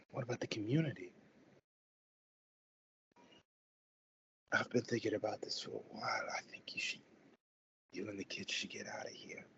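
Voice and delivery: hushed urgent whisper to a spouse; whispered worry. Acted. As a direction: keep it secret from the kids, breathy and low. Parents talk secretly at night.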